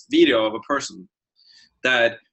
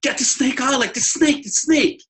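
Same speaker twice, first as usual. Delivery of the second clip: high, rising frantic insistence; retold dream panic, pointing at the snake; panicked yelling; 12 semitones higher than usual. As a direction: shout it higher each time. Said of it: "I was like".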